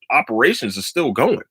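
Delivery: ordinary talk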